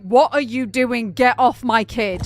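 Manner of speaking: loud, drawn-out protective outrage; angry retold confrontation. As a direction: act out a mother warning someone off, loud and slow. Retells mother confronting would-be kidnapper.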